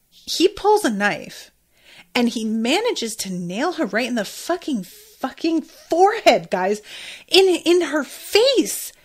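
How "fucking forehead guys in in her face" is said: higher, louder and slower, stunned disbelief; shocked excitement. Real, not acted. Telling viewers about the knife to the forehead, stunned and loud. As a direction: stretch it out, still reeling from the shock. Shocked recounting of stabbing scene.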